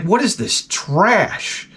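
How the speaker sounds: slow, scornful drawl; disgusted. Retold or imitated. Disappointed visitor in haunted house, slow scornful. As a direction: sneer at it slowly. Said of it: "I'm like".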